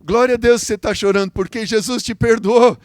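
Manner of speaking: loud, high-pitched jubilant praise; júbilo. Retold or imitated. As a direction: exclaim it with preacher's joy. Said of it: "eu comecei a rir, a sorrir". (exclamação alta e jubilosa de louvor)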